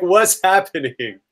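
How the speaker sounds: high, stretched-out demand; acted bewildered plea; alarmed question. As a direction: ask in rising alarm, drawing it out. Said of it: "I'm like".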